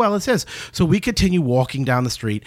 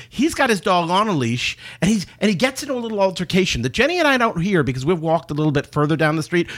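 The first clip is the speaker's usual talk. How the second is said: ordinary talk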